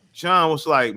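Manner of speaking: ordinary delivery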